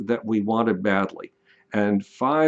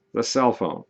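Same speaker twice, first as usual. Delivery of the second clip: normal talking voice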